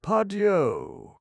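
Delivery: very high, loud Pardieu; surprised cry. A narrator's reading. Aubry spotting Herman, loud surprised cry. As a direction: burst out in surprise. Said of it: "cried Aubrey".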